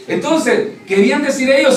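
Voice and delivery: normal talking voice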